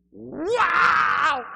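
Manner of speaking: high, very loud wail; cattle-prod shriek. Retold or imitated. Lady being prayed over, sudden high mock shriek. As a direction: yell as if jolted by a cattle prod. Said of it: "like somebody hit her in the head with a cattle prod".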